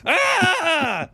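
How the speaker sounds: high scream. Acted. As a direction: scream out in panic, high and loud.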